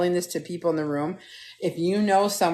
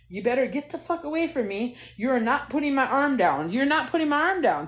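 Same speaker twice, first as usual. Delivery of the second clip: high-pitched mock defiance; acted freak-out; 7 semitones higher than usual. Patient on gurney warning staff off her arm, high frantic refusal. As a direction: warn them off, voice climbing. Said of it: "I'm going nuts, right?".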